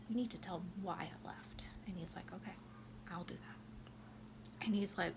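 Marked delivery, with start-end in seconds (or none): 0.1-1.7 whisper. Retold or imitated. Violetta's secret request, retold. 2.3-4.6 whisper. Retold or imitated. Hushed reply in retold scene.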